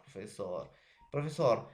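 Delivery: ordinary talk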